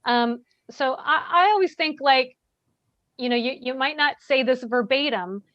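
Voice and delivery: normal talking voice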